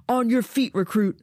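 loud barked command. Acted. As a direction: order him up sharply. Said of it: "Sergeant Valerius commands".

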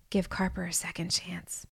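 soft, hushed divine reply; quiet inner voice. A narrator's reading. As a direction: let it come softly from within. Divine voice answers.

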